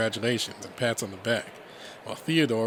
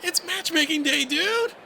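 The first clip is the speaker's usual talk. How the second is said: high, loud, thrilled exclamation; hyped-up squeal to a buddy; excited shout; 5 dB louder than usual. Acted. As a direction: yell it gleefully over a crowd. Redline thrilled over crowd noise.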